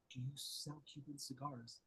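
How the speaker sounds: hushed, slow secret whisper. Retold or imitated. Asking the cigar shop owner in a whisper. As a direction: breathe the question so nobody hears. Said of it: "but I was whispering".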